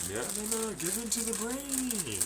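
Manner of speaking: mock brain tempting the eater; high coaxing voice.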